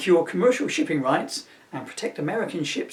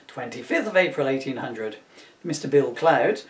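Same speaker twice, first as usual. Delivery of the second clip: ordinary delivery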